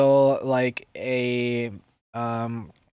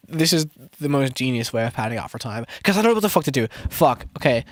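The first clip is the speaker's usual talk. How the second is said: ordinary delivery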